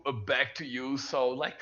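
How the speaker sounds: ordinary delivery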